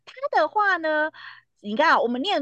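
normal talking voice

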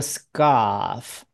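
"scarf" as a long "skahf", no R; British silent R. Shown as a demonstration. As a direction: draw out British "scarf" without curling the tongue. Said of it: "英国人就不卷舌了". (英式 scarf，不卷舌)

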